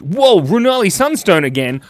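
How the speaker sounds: loud, high whoa of delight; real excited exclamation; excited surprise. Real, not acted. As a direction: burst out high and loud at a returning supporter. Surprised by a returning supporter.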